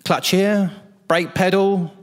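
high-pitched, breezy instructor patter; imitated cheery vehicle owner. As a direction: rattle off the controls like it's nothing. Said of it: "He goes".

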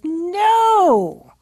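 loud, high, drawn-out no; emphatic refusal. Retold or imitated. Mother quoting her son's response to a credit card, emphatic refusal. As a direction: imitate a resounding refusal, stretched and falling.

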